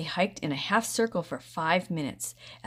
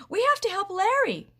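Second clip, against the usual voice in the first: loud, very high urgent call; urgent shout; 6 dB louder than usual. A narrator's reading. Brother shouting for Rusty to come back. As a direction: plead loudly for help. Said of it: "he shouted".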